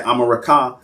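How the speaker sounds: America said as "ah-ma-ra-KAH"; original Mayan pronunciation. Shown as a demonstration. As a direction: open the vowels of America, ending on KAH. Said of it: "the Europeans heard them say".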